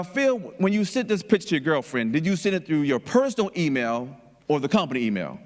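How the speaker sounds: usual speaking voice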